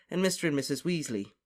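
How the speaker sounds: usual speaking voice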